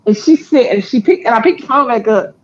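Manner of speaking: normal talking voice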